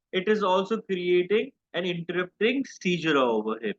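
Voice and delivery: normal talking voice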